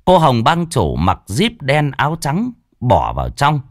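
usual speaking voice

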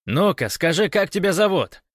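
high, loud questioning; guard demanding across a distance; shouted demand. A narrator's reading. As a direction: call out an order to answer. Guard demands name across barricade.

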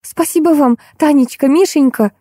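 put-on high, ringing girlish thanks; tinkling little bell. A narrator's reading. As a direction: chime out thanks sweetly. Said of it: "колокольчиком прозвенела Машенька". (звенит колокольчиком, высоко)

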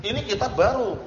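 counterexample, voice lifted; wrong example. Shown as a demonstration. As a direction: pitch the wrong version up. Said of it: "Jangan gitu, itu keliru".

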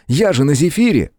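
high, excited boast; boyish, high-pitched enthusiasm; eager reassurance. A narrator's reading. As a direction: boast brightly about the vehicle. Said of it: "успокоил его Том".

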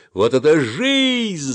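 high yell, stretched on the last word; exultant yell. A narrator's reading. Boy joins friends shouting under the arch, yelling. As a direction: holler it out, dragging ЖИЗНЬ. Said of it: "заорал я".

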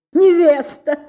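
high, loud shocked cry; stunned exclamation. A narrator's reading. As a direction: gasp the word out in shock. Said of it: "воскликнул воротничок". (высоко и громко, в изумлении: «Невеста!»)

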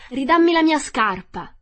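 high, loud angry shout; angry demand. Acted. Dorothy yelling at the witch for her shoe, angry. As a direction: snap at her to give it back. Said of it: "si adirò e disse alla strega".

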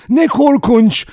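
high, loud cry of shock; horrified cry. A narrator's reading. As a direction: cry out in horror. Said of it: "diye haykırdı Vera".

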